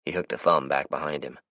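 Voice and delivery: plain reading voice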